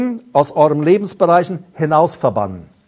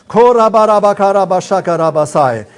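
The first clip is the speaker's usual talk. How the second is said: fluent, flowing glossolalia; praying in tongues; 3 dB louder than usual. Real, not acted. Preacher praying in tongues before the congregation, flowing and fervent. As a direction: let the tongues pour out steadily.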